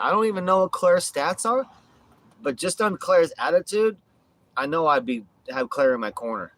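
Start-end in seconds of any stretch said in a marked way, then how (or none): none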